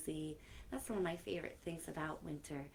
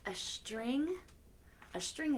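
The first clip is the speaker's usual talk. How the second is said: lilting storybook reading; reading to children; 2 semitones higher than usual. Acted. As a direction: read the rhyme to the kids with playful lilt. Reading new book aloud.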